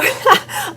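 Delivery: very high falling giggle. Real, not acted. Soaper apologizing for her laugh, high giggle. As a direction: giggle up high and let it fall. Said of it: "I just get the giggles".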